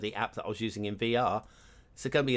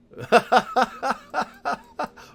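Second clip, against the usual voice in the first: loud, high burst of laughter; real laugh; 12 semitones higher, 8 dB louder than usual. Real, not acted. Player amused by his own butchered French, loud laughing.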